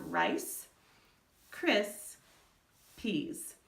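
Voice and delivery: rice, cris, peas said as three separate beats with pauses; syllables broken apart. Shown as a demonstration. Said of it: "move an index card".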